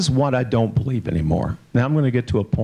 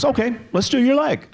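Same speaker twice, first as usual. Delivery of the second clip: higher, breezy mock voice of the painter girl; body painter's reply; 12 semitones higher than usual. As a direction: play her cheerful and much higher. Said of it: "And she goes".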